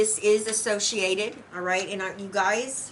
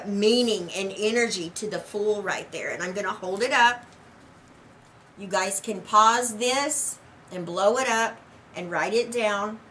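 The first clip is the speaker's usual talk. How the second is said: ordinary delivery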